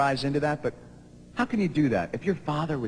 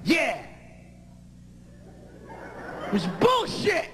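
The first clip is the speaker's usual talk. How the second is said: riled-up yelling; 5 semitones higher, 3 dB quieter, slower than usual. Retold or imitated. Friends riled up about verdict.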